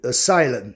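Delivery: "asylum" stressed on the middle syllable, uh-SY-lum; English pronunciation. Shown as a demonstration. Teacher shows students an English exception, "asylum" said uh-SY-lum. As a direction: say "asylum" the English way, falling low. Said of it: "inclusive as suas exceções".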